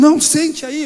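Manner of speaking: acted-out loud objection; mock sharp order. Leader stops him sitting down.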